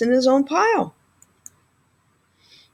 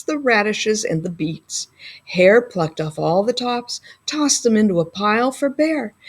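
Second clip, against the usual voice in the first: ordinary delivery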